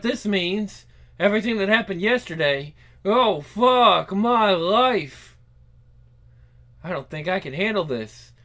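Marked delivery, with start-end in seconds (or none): 3.0-5.4 drawn-out despair. Acted. Despairing groan.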